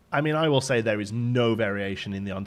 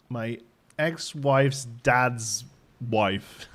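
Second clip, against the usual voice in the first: ordinary talk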